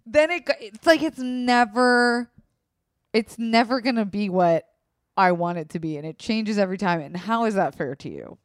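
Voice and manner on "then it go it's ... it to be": higher, louder, rising upset; emotional. Real, not acted. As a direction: let the frustration spill over. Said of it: "Do you hear how emotional I am?".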